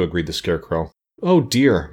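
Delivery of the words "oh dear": mild, higher-pitched moan of dismay; a touch of self-pitying woe. A narrator's reading. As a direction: moan it as a woeful complaint. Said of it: "moaned Jack".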